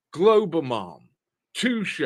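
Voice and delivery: ordinary delivery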